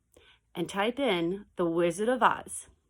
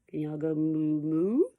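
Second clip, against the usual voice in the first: ordinary delivery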